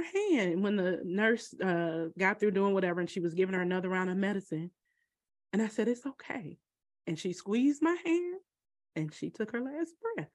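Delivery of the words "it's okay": gentle whisper. Retold or imitated. Comforting mother at death.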